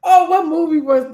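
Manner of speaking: fighting back real tears; tearful. Real, not acted. Telling her friend about a show, real tears. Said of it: "You crying, really?".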